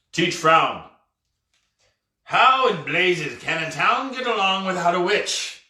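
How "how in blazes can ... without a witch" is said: ordinary delivery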